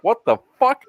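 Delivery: high, startled imitation of the guard; mock shocked guard. Imitating the startled guard pulling out chainmail.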